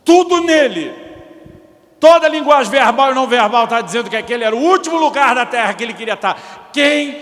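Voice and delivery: wife venting to the pastor, heated; exasperated complaint. Retold or imitated. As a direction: let the frustration pour out. Wife's heated complaint retold.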